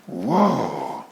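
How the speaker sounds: a soft, low "whoa" of wonder; hushed awe. Pretend. A man admiring a tree, hushed wonder. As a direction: marvel at it quietly. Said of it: "you stop and you go".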